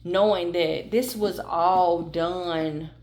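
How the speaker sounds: soothing reassurance. Acted. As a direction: ease them into peace, gently and softly. Closing reassuring message to viewer.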